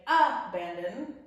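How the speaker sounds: abandon with the first syllable A punched loud; wrong stress. Shown as a demonstration. Teacher showing pupils the wrong way, first syllable of abandon stressed. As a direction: hit the opening A of abandon hard. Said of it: "We put the emphasis on the middle syllable".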